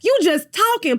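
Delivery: high mock Portia voice, rambling. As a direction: imitate her high and loud, babbling. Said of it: "She was like".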